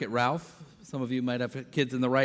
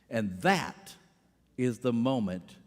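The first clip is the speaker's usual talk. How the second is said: ordinary talk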